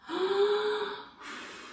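high startled gasp; sharp high gasp. Acted. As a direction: gasp as the owl falls. Owl blown off branch.

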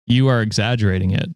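usual speaking voice

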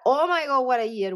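high, delighted gasp of excitement; sudden excited exclamation, pitch jumping up; thrilled outburst. Real, not acted. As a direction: burst out in real delight at the cards. Reader thrilled by the cards.

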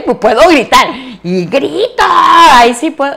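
mock shout. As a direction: belt it out to prove you can shout. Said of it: "¿Quieres que grite?". (grito fingido y juguetón)

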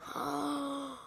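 long soft intake of breath; shocked gasp. Real, not acted. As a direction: gasp before a big outburst. Bad mobs appear.

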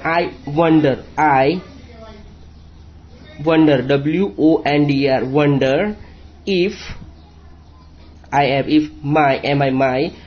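each word read very slowly, then spelled letter by letter; slow spelling-out. Shown as a demonstration. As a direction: spell it out slowly for young pupils. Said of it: "spelling कैसे पढ़ना है". (धीरे-धीरे शब्द और spelling पढ़ना)

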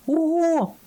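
very high-pitched shriek of surprise; startled cry. A narrator's reading. Brioche jumping up as water floods the raft, crying out. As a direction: yelp it out high. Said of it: "скрикнула Бріош".

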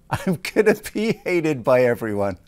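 laughing through the words. Real, not acted. Actor jokes about being hated for his villain role, laughing. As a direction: chuckle while owning the villain part.

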